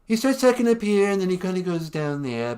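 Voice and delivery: sing-songy, starting up high and sliding down. Shown as a demonstration. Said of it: "he's got kind of a sing-songy voice".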